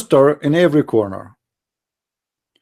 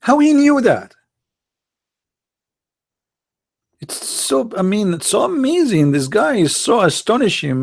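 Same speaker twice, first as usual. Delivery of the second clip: sarcastic awe; 5 semitones higher than usual. Acted.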